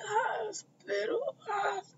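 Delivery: high faint slow whimper; barely conscious, fading voice; groggy whimper. Retold or imitated. Finn groggy, barely speaking.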